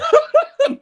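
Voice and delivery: high-pitched burst of laughter; real laugh. Real, not acted. Laughing at co-host's teasing.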